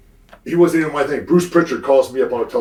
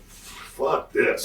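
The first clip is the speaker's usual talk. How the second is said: unvoiced, softer fuck this; whispered curse; 6 dB quieter than usual. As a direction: breathe it out as a fed-up whisper. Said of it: "I said".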